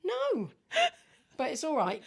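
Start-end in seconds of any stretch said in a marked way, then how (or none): 0.0-0.5 high laughing no. Retold or imitated. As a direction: squeak it out laughing. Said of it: "I'm like".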